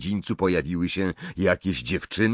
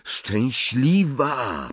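higher, drawn-out word of dawning insight; sudden realisation; 6 semitones higher, much slower than usual. A narrator's reading. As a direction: stretch it slowly as the idea lands. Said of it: "powiedział nagle Ron". (nagłe olśnienie, przeciągle)

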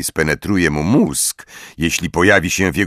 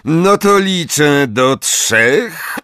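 slow, drawn-out bored drawl; weary, stretched-out announcement; 8 semitones higher, 3 dB louder, much slower than usual. A narrator's reading. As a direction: drag it out at a higher pitch, utterly bored. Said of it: "powiedział znudzonym głosem Snape".

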